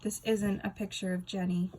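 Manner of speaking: usual speaking voice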